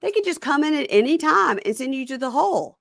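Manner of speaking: put-on high, self-pitying boyfriend; whiny inmate voice. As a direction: complain in a high whine. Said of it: "and he goes".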